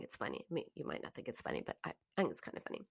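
fast, whispered aside to listeners. Real, not acted. A whispered joking aside while telling the funny story. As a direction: slip this in under your breath, quickly.

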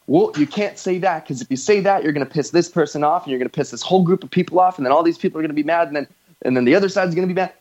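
imitated worrier, words tumbling out fast; rattled-off objection. Host mimicking people who fear pissing everyone off, rapid-fire. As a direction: rush through a mock chain of objections.

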